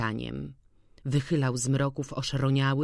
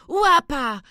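much higher, louder call to the dog; louder joyful cry; 12 semitones higher, 7 dB louder than usual. A narrator's reading. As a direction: shout his name again, overjoyed. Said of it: "wykrzykiwał".

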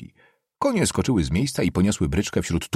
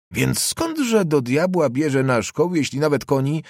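exasperated questioning; 7 semitones higher, 3 dB louder than usual. Acted. Nobleman grilling the boy about his father, exasperated. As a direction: press the question in raised-pitch bafflement.

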